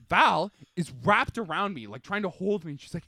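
normal talking voice

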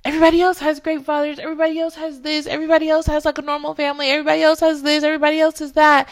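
loud, high, rapid-fire litany of grievance; acted complaint. Voicing complaint to God about others.

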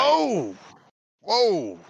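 high startled yelp; startled whoa. Acted. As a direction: jump back in alarm. NPC startled by threat.

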